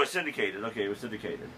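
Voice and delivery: ordinary talk, nothing marked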